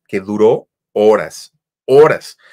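slow then loud stress on horas; drawn-out emphasis. Retold or imitated. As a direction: stretch out horas slowly, then punch the second horas loud. Caller's endless fight retold.

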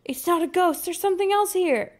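high, loud urgent warning. Acted. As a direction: shout it to warn your friends. Warning the friends about the creature.

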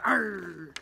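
growly pirate arr. Pretend. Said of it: "we should go".

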